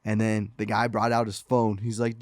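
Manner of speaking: usual speaking voice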